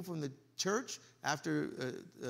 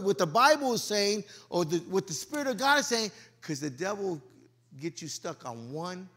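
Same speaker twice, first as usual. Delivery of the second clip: ordinary delivery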